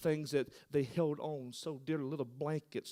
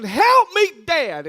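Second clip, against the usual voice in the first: high, loud scream of Help me; a drowning boy yelling to his dad; 11 semitones higher, 16 dB louder, slower than usual. Acted.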